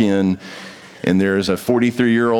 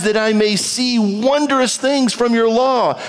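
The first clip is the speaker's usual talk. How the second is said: slow, raised reading of a psalm over music; scripture reading; 11 semitones higher than usual. Acted. Preacher reading scripture to the church, slow and elevated. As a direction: read the psalm to the congregation, slow and lifted.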